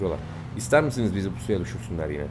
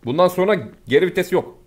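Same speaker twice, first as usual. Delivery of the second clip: rallying shout; 6 semitones higher, 6 dB louder than usual. Real, not acted. Charging in, no retreat.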